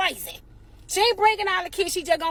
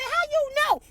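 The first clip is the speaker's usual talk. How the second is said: incredulous squeak; 10 semitones higher than usual. Retold or imitated. Incredulous at daughter.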